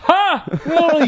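very high, loud mock TV-character laugh and cry; horrible Alf impression. Co-host joking it's the same Alf, loud high impression. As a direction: burst out with a hammed-up, high-pitched character voice. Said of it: "That was horrible".

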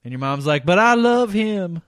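ordinary talk

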